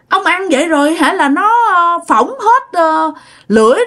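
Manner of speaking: a mother aghast at scalding-hot porridge; startled alarm. Retold or imitated. Mother alarmed at husband gulping hot porridge, high startled scolding. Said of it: "Cái mẹ mới hết hồn".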